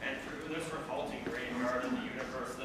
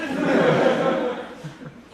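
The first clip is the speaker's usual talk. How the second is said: a big helpless laugh, much louder; loud burst of laughter; 9 semitones higher, 11 dB louder than usual. Real, not acted. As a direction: crack up at the absurd poem. Breaks up at own reading.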